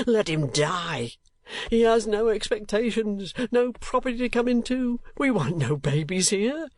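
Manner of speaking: high, slow, wild outburst; father raging at the nurse, reckless; reckless rage. A narrator's reading. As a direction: rant with reckless fury. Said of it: "said Mister Kenwigs recklessly".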